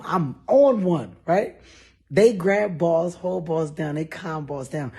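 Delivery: ordinary delivery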